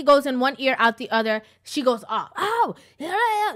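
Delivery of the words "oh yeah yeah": imitating her shrill outburst, pitched way up; high mock scream of an angry driver.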